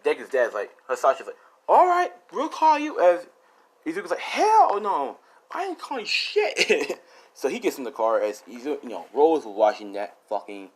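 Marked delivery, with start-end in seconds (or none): 1.7-3.0 high, called-out farewell; acted cheery reply to the girls; called-back goodbye. Voicing dad calling back goodbye. 4.2-7.0 high, emphatic mock outrage; acted comic indignation; indignant refusal. Voicing his indignant refusal.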